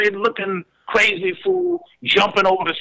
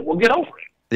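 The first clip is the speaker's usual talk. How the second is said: ordinary talk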